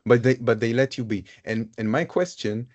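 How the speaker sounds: usual speaking voice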